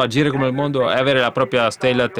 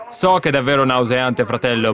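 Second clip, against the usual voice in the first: ordinary talk, nothing marked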